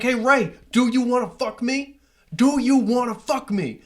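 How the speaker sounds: loud, high-pitched confrontational yell; mad-dogging. Retold or imitated. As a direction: imitate an aggressive challenge across a party. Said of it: "like, mad dogging him".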